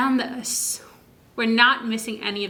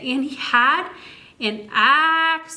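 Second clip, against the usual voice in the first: "axolotl" drawn out slowly, a bit higher; stretched out slowly; 4 semitones higher than usual. Shown as a demonstration. Reading to a child, stretching "axolotl" out slowly. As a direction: stretch every sound of "axolotl". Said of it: "stretch words out very slowly".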